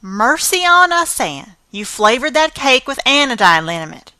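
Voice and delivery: exasperated scolding. Acted. Marilla exasperated.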